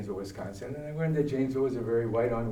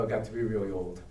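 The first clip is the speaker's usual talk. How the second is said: ordinary talk, nothing marked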